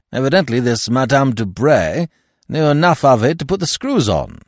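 loud, high, vehement Stoner. Acted. Stoner explaining the blackmail to the magistrate, vehement. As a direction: push the theory hard and loud.

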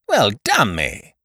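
slow, hurt exclamation; aggrieved. A narrator's reading. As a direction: sound wronged.

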